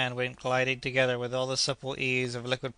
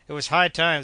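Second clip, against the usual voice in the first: relieved outburst; 4 semitones higher, 8 dB louder than usual. A narrator's reading. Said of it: "said the doctor".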